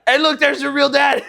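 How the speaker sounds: high, loud teasing shout; mock excited callout. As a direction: call out gleefully to wind someone up. Dad loudly trolling his kid.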